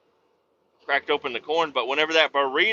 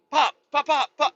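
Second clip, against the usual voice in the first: popping sound imitation; 14 semitones higher than usual.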